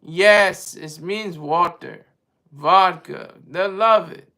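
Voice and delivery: badly faked Russian accent, slipping a little into Spanish; terrible Russian accent. Russian answering a doubter about vodka, in a terrible Russian accent. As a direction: play it as a mock Russian with a clumsy accent. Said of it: "That's my terrible Russian accent".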